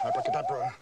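very high squeak on abracadabra; squeaky magic word. Acted. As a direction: squeak it out like a conjuror.

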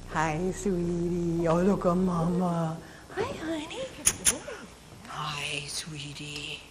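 hushed, tender greeting to a dog. Real, not acted. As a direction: coo softly at the dog.

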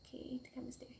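a quick hushed note to self; whispered aside. Real, not acted. Vlogger reminding herself where the camera is, whisper. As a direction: whisper it to yourself. Aside while fixing her eyeline.

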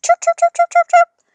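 very high, loud shoo and chirping cries; shrill shooing that turns into birdlike chirps; frantic shooing. A narrator's reading. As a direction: flap the birds away, squealing. Grandma shooing birds, mimicking chirps.

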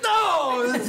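put-on little girl's no. Little sister missing again, wails in a high childish voice. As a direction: wail it like a thwarted kid. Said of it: "She's like".

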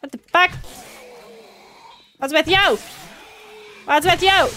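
high, loud outburst; exasperated shouting. Real, not acted. Player demands what is with the attacker, exasperated shout. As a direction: snap it out loudly, baffled and fed up.

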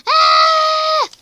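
loud high drawn-out shout; long scream. Acted. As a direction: scream while running away. Fleeing cat screams.

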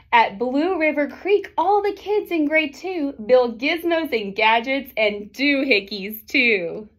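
lively picture-book read-aloud; reading to children. A narrator's reading. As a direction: read the rhyming story to the class.

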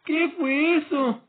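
high, slow stunned yelp; startled cry. Real, not acted. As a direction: gasp it out, totally stunned. Startled by sudden kill.

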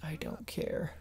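unvoiced whispered aside. Real, not acted. Gamer whispering his indifference to the 3DS news. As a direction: breathe it out dryly.

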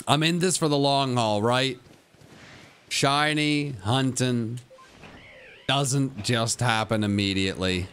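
ordinary delivery

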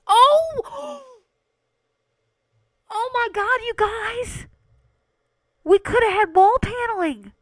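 high, loud delighted shriek; excited squeal. Real, not acted. Gamer squealing to chat over wall paneling, thrilled. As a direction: squeal with real joy at the find.

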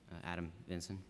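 softly spoken name; too quiet. Real, not acted. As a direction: say your name well under normal volume. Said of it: "speak a little bit louder".

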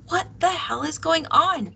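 high, agitated outburst; alarmed demand. A narrator's reading. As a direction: demand to know what is happening. Kara demands answer amid quarrel.

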